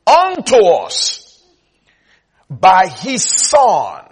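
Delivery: rhythmic repeats building to loud, drawn-out words; forceful preaching. Real, not acted. Preacher hammering a verse to the congregation, building. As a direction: build the verse to a slow, ringing peak.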